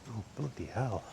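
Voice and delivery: usual speaking voice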